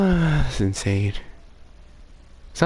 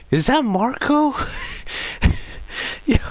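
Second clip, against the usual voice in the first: sudden high excited call; 14 semitones higher than usual. Real, not acted. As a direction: blurt it out, thrilled. Recognizing a YouTuber's voice.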